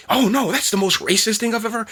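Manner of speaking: high-pitched, hammed-up scandalized reaction; mock outrage. Vlogger mocks a whole room crying racism, mock-outraged and high.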